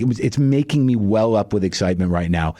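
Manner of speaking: ordinary talk, nothing marked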